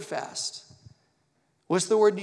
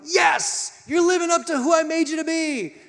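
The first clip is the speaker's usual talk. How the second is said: loud, high-pitched jubilant cheer as God; triumphant shout of divine approval; 11 semitones higher, 6 dB louder than usual. Acted. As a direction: exult at full voice like a proud God. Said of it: "Is our God going".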